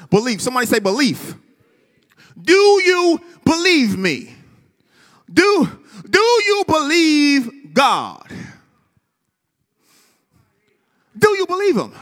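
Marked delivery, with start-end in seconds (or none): 2.4-4.3 loud, slow, high call to the crowd. Real, not acted. Preacher calling out to the congregation, loud and slow. 5.3-11.9 loud, slow, high call to the crowd. Real, not acted. Preacher calling out to the congregation, loud and slow.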